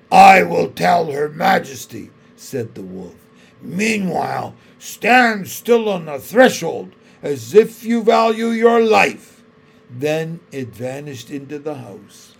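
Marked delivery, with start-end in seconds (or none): 0.1-2.1 louder, menacing wolf guard. Acted. Wolf guard answers Edmund. 3.6-9.4 louder, menacing wolf guard. Acted. Wolf guard threatens Edmund.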